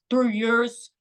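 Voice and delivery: imitating a teenage son's northern English accent; lightly mimicked English accent; deep English accent. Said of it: "with his English accent, his deep voice".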